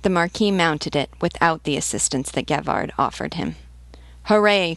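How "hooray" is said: higher, louder drawn-out cheer; cheering cry. A narrator's reading. Peasants cheering as their Marquis mounts, raised cheering cry. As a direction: call out a hearty hurrah. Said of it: "cried the peasants".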